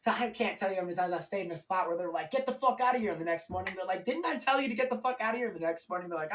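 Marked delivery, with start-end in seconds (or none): 2.3-3.1 angry eviction. Retold or imitated. People kicking him out. 4.0-5.5 irritated yell. Retold or imitated. People kicking him out again.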